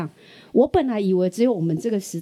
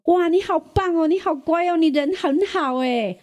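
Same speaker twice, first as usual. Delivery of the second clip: much higher, bubbly mock praise; gushing southern praise; 9 semitones higher than usual. Son imitates southerners praising a stranger, gushing and high. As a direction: gush like an overjoyed auntie. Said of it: "但是我们南部人就说".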